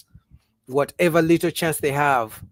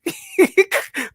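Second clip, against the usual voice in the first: loud high laugh; 6 dB louder than usual. Real, not acted. Laughing at his own joke, high burst of laughter.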